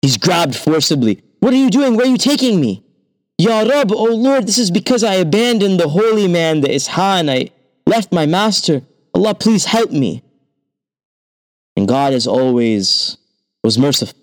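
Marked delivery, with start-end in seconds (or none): 1.4-2.8 panicked disciple. A narrator's reading. Disciple grabbed by the hangman, high panicked cry. 3.4-10.2 high, pleading cry to God; desperate prayer. A narrator's reading. Seized disciple praying for rescue, high desperate plea.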